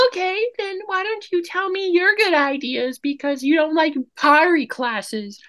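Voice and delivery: very high-pitched mock girlfriend voice; high, put-on mocking quote; imitating the girlfriend. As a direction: mimic her challenge in falsetto, stretching the end. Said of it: "she's like".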